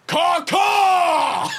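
high shouted caw caw; crow caw. Pretend. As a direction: squawk like a crow. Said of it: "And Luca says".

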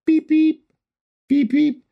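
sing-song machine beeping; imitated monitor beep. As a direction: mimic the oxygen alarm going off. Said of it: "My heart rate's up".

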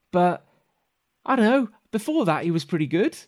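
put-on high-pitched, hesitant child voice; earnest kid. Kid vouching for his dad as leader, high and hesitant. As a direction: think aloud as a hopeful high-voiced kid. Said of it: "The kid".

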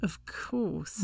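dry whispered agreement; whispered aside. Real, not acted. Co-host playing along with blanket joke, dry whisper. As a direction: drop to a whisper, deadpan.

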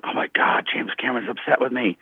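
soft, under-the-breath retold panic; hushed mock alarm. Trainer to himself after upsetting the director, hushed mock alarm. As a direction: drop the volume for a dismayed private aside. His alarmed inner reaction.

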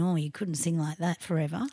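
ordinary talk, nothing marked

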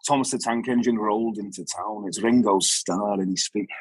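lightly voiced Scouse storyteller; mild Liverpool Scouse narration. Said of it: "he has this very definite Scouse accent".

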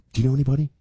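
whispered sly inquiry; furtive whisper. Retold or imitated. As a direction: whisper it like an under-the-table request. AOC's people asking furtively.

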